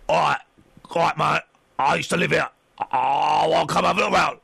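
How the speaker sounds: rough-and-ready big bloke at the door; big tattooed fella. Acted. Topless big fella asks to look round his old house, big bloke voice. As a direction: put on a hulking doorstep visitor, in short bursts. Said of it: "big fella comes in".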